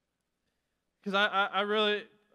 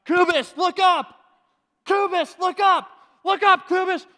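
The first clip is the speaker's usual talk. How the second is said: loud, high shouted coaching; mock coach shouting look up; coach yelling; 11 semitones higher, 10 dB louder than usual. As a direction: bark it like a coach from the sideline. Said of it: "I would hear all the time".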